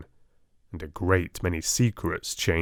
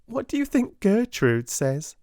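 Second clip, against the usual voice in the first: giggling; 14 semitones higher than usual. Acted. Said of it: "with a new giggle".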